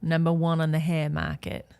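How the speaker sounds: low, soft mock Aussie accent; Australian accent. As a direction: say it quietly in a put-on Australian accent. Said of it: "I love to talk with an Australian accent".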